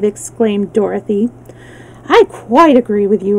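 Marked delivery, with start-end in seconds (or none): none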